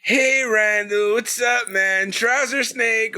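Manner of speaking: stoner impression. Mimicking stoner character.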